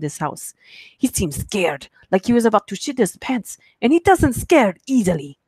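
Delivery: shaky attempt at a Russian accent. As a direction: read it aloud in a put-on Russian accent. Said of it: "I'm gonna try and do a Russian".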